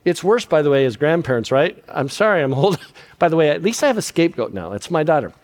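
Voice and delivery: ordinary talk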